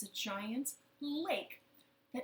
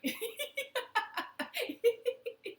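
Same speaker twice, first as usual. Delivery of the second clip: high, bubbling burst of laughter; real laugh; 13 semitones higher, 3 dB louder than usual. Real, not acted. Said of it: "we'll all laugh together".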